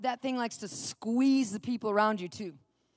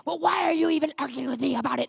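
put-on combative outburst; indignant, argumentative retort; mock flare-up; 3 semitones higher, faster than usual. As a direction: imitate a husband snapping back. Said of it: "flare up at me again".

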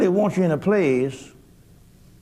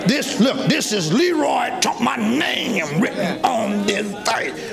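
preaching at full force; 6 semitones higher than usual. Acted. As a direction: cry it out to the church, full voice. Preacher shouting his own verse.

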